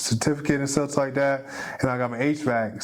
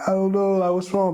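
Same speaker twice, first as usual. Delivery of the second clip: daughter's voice; 7 semitones higher than usual. Retold or imitated. Father quotes daughter's frustrated words.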